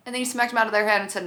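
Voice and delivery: usual speaking voice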